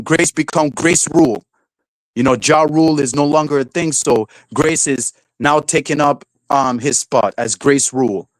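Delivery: ordinary delivery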